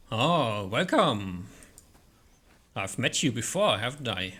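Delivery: lightly voiced big dragon greeting; loud, boomy dragon voice. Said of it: "loud, boomy voice of the dragon".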